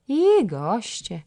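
slow, shrugging scorn; dismissive. A narrator's reading. Jagna shrugs off the visitors, slow and dismissive. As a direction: drag it out, unimpressed. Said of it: "ruszając ramionami".

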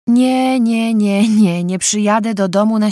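loud, emphatic no; firm loud refusal. Acted. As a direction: cut her off loudly. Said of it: "zaczyna, uprzedzając falę wyrzutów". (głośna, stanowcza odmowa)

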